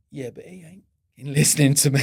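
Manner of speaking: loud, laughing retelling of the buyer's punchline; can barely get the line out for laughing; buyer's blunt retort to the supplier, voiced through loud laughter; laughing through the quote.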